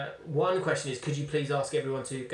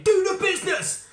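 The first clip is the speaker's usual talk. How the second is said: retold yell; 10 dB louder than usual.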